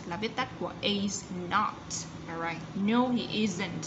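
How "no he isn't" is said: slow, the final T of "isn't" clearly released. Shown as a demonstration. Teacher modelling the ending sound for pupils, slow. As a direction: slowly stress the T at the end of "isn't". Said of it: "ending sound T".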